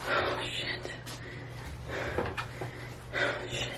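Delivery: soft, stunned whispered curse; shocked whisper. Real, not acted. Reading a positive pregnancy test.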